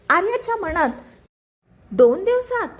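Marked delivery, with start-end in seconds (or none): 1.9-2.7 high, loud shocked echo; shocked as the deadline sinks in. A narrator's reading. Wife hearing husband leaves in two days, shocked.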